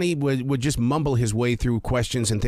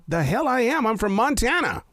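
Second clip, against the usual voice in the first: high-pitched indignant comeback; indignant retort; 10 semitones higher than usual. Retold or imitated. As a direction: protest your origins in a raised pitch. Mock retort to being taken for Southern.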